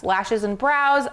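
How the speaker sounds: normal talking voice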